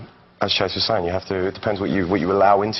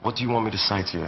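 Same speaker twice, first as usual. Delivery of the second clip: natural British accent, softer; real British accent; 5 dB quieter, faster than usual. Shown as a demonstration. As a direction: say it in your own English accent. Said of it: "the same thing in your real".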